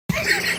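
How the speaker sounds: haughty laugh. Acted. Villainous cackle at reputation.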